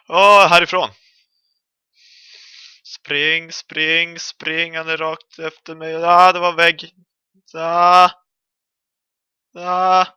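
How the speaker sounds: high, loud frantic yells; panicked yelling. Real, not acted. Gamer chased by the monster, yelling in panic. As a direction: shout in panic while running.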